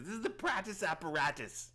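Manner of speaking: high-pitched hermit showing off; grand introduction in a put-on high voice; Prattis. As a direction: present it proudly as an eccentric hermit. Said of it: "his name's Prattis".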